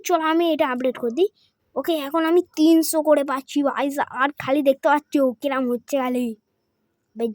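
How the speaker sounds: ordinary delivery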